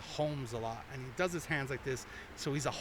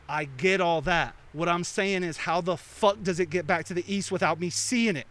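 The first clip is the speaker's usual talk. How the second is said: louder, slow, frustrated insistence; exasperated; 3 semitones higher, 7 dB louder than usual. Retold or imitated. Confused guy losing patience with Mister Wonderful, louder and slower. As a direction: hammer each word out in frustration. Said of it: "this dude loses his shit".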